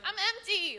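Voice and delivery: high-pitched needy plea; complaining body. Acted. Her body answers her brain, high and needy. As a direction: wail it lightly as a hungry body. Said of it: "my body's like".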